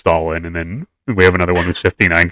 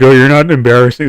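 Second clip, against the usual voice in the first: higher and louder insistent protest; emphatic reassurance; 7 semitones higher, 9 dB louder than usual. Retold or imitated. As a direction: insist loudly that it isn't true. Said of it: "He's like".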